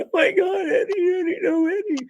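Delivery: high, crying wail; imitated grieving scream. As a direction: imitate a sister screaming through tears. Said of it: "was crying, screaming".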